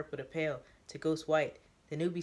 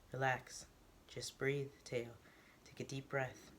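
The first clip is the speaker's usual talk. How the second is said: softer, soothing and reassuring; calming; 3 semitones lower, 8 dB quieter than usual. Acted. Newbie calms the armed Teo, soft and soothing. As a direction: talk him down gently.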